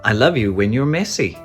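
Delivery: ordinary delivery, nothing marked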